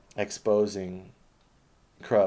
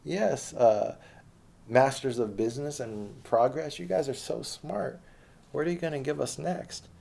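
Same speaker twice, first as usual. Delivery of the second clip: sarcastic fawning; 7 semitones higher than usual. Acted. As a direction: sneer while pretending to worship. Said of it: "I'm getting emotional".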